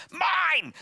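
put-on high, loud toddler shout; selfish child. Child refusing to share, selfish toddler shout. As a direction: yell it like a possessive little kid.

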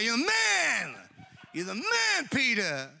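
high, drawn-out hype on "man"; hammed-up gushing praise; mock admiration. Jesus imagined praising Peter, mock excited admiration. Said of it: "His loaded question was like".

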